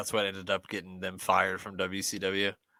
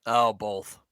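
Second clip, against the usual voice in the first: normal talking voice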